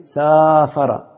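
سافر with a very long aa after س; stretched long vowel. Shown as a demonstration. Teacher giving students an example, سافر with its first vowel greatly stretched. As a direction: draw out the alif in سافر.

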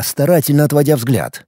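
ordinary delivery

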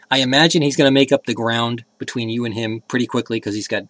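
ordinary talk, nothing marked

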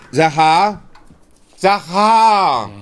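loud call across the house. Acted. Master summoning his servant Sachar, a loud call.

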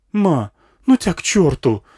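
put-on high, loud, slow kid's voice; defiant boy. A narrator's reading. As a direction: tell mum off like a fed-up child. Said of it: "сказал пацан".

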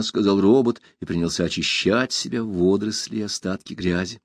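ordinary delivery, nothing marked

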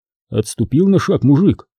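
usual speaking voice

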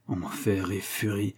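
slow, soft inward grumble; grumbled to himself. A narrator's reading. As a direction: grumble the curse under your breath, drawn out. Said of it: "grommela le Major in petto".

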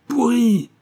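high, loud shriek of contempt; screamed insult. A narrator's reading. Concierge screaming an insult at Simon, high and loud. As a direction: spit the word out as a scream. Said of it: "vociféra la concierge".

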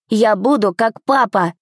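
put-on slow, mild boyish exclamation; lightly voiced excited little boy; exclaiming. A narrator's reading. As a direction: declare it like an eager child. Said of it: "воскликнул мальчик".

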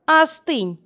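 high, thin command; thin little voice. Acted. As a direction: snap a high little order from the side. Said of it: "велел ей откуда-то сбоку тонкий голосок".

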